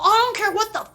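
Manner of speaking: hammed-up angry officer; imitating angry cop. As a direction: play the cop as rude and impatient. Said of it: "He's all".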